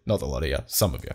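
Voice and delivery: low, quiet climb-down; muted backtrack. Real, not acted. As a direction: drop right down to walk it back. Backing down from an accusation.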